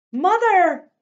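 very high, loud anguished scream. Acted. Girl seeing her mother dumped in the street, anguished scream. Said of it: "I screamed".

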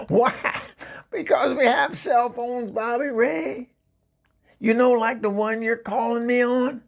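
high-pitched, incredulous exasperation; exasperated. Acted. Exasperated at ex-husband's carrier pigeon idea.

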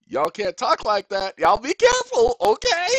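high, loud stern warning; angry warning. Acted. Warning the court to watch how they talk, angry. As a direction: warn them off, voice climbing. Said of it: "I'll say it again".

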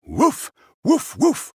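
high, loud barking woofs; dog barking. Acted. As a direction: bark it out like an eager dog. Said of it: "Doug barked".

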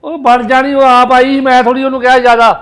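very loud, low-pitched retort; angry insistence. Acted. Son defending his lover to mother, angry insistence. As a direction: shout down the objection.

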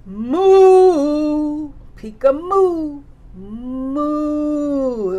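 loud drawn-out moo; cow moo. Acted. Teacher to toddler, mooing loudly like a cow.

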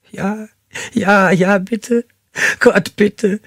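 very high, sobbing pleas; terrified begging. A narrator's reading. Captive begs him to take the blade away, high and sobbing. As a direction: beg desperately through tears.

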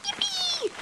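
high squealed yippee; excited yippee. Real, not acted. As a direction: squeal it with glee. Cheering while running out.

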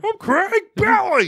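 squeaky high falsetto, loud; mock his friend in falsetto; Craig Bentley voice. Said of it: "we got Craig Bentley on the mic".

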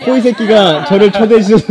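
mocking the slip through laughter; laughing. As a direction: tease the mispronunciation, laughing loudly. Teasing repeat of guest's slip.

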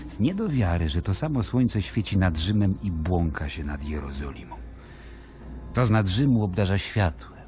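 ordinary delivery

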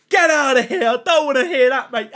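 high, loud outburst of protest. Real, not acted. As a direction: shout the chat down. Exclaims at unwelcome chat message.

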